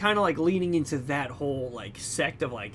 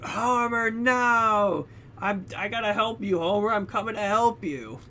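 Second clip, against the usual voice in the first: desperate call to Homer; 6 semitones higher than usual. Retold or imitated. Other guy calls to Homer.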